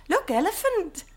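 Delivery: ordinary talk, nothing marked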